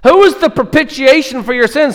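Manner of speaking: quizzing angel. Acted. Angel asks theology question.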